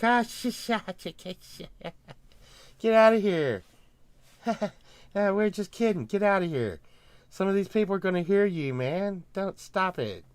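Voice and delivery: a lightly voiced nervous official shushing; mimicking officials. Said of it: "they're like".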